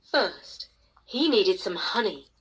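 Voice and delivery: ordinary delivery, nothing marked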